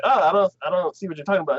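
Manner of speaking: fast, dismissive; dismissive dad. Retold or imitated. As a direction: brush her off quickly. Dad voiced.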